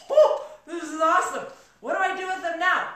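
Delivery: high, loud burst of delight with a laugh; excited reaction. Retold or imitated. Telling the audience how thrilled she was, excited.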